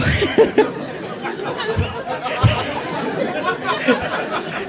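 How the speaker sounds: mock helpless laughing fit, very breathless; dying of laughter. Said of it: "He keeps dying".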